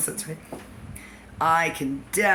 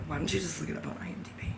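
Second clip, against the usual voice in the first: whispered Trace; 9 dB quieter, much faster than usual. Retold or imitated. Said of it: "I think Trace is like".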